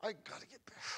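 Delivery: a hushed broken man urging himself up; whispered resolve. Retold or imitated. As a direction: whisper it to yourself, broken but rising. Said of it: "he started going".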